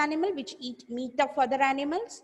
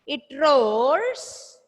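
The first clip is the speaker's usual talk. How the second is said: loud, stretched-out roars, rising; loud repeat-after-me; 6 dB louder than usual. Shown as a demonstration. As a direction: project and draw out the roar word. Model phrase for pupils to repeat.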